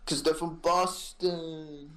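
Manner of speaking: thick mock Boston accent; slow, drawn-out Boston bro. Mocking Boston bros.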